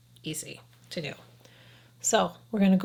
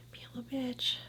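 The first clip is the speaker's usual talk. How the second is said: breathy under-the-breath whisper, much softer; whispered aside; 3 semitones higher, 13 dB quieter than usual. Real, not acted. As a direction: mutter it to yourself in a whisper. Aside about gross lashes.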